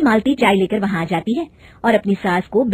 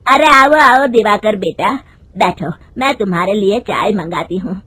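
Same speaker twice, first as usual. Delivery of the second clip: louder hearty elder welcoming a guest; lady of the house calling out; mother-in-law; 4 semitones higher, 5 dB louder, slower than usual. Acted. Mother-in-law welcomes Diwakar and calls for tea, loud hearty.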